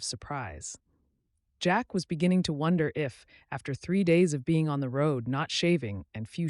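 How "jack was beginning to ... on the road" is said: ordinary delivery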